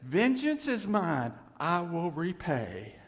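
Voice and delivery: loud, slow, soaring pulpit build; rising to a booming sermon climax; preaching at full force. Real, not acted. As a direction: thunder it out to the congregation.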